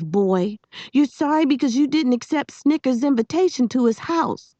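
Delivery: high, emphatic telling-off; angry scolding. A narrator's reading. Girl woken at night telling boy why he's sorry, scolding.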